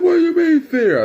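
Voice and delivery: very high, loud mock indignation; indignant dad. Dad objecting to son's answer, indignant yelling. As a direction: yell it back as an outraged father.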